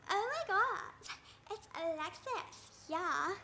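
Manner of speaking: high, drawn-out catty call; stupid, showy voice of a rival; mocking yell. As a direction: call out slowly so everyone hears. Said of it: "yells so everyone can hear her stupid voice".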